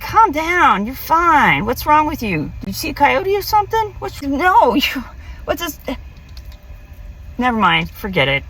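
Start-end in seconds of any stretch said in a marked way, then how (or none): 0.0-5.8 high mocking friend voice; squeaky incredulous friend; friend's voice. Imitating the friend telling her to calm down, high and mocking. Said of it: "and he's like".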